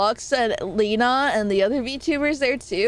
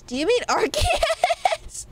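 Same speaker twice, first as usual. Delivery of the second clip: mock confused; 12 semitones higher, slower than usual. Playful mock confusion, high.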